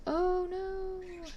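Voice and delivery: high, drawn-out cry of dismay. Real, not acted. Crafter seeing resin land in the wrong place, dismayed. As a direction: let out a high-pitched little wail.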